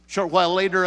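normal talking voice

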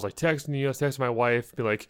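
normal talking voice

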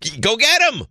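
high, excited shout of go get him; mock rallying cry. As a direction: urge them on like a fan in the stands. Urging the team to grab Lamar.

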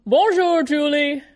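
cohost says Bonjour with a crisper French accent; better French bonjour. Shown as a demonstration. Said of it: "That's a much better pronunciation of it".

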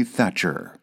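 ordinary delivery

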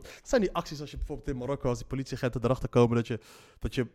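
ordinary talk

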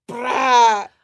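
very loud thunderclap noise; imitated thunder. Storyteller imitating thunder as owner warns them, loud crash. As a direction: crack out a booming thunder imitation. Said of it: "Y el trueno".

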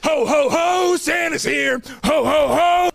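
booming jolly Santa shout. Pretend.

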